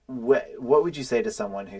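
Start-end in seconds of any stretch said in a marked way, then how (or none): none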